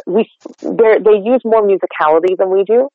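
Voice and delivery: ordinary delivery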